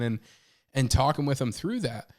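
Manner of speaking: usual speaking voice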